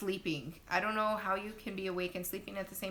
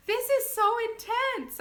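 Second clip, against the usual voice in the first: very high, loud mock cry of shock; retold shock; 8 dB louder than usual. Labouring mom to laughing nurse, shocked exclamation. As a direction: exclaim it as if overwhelmed. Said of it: "I was so shocked on how intense".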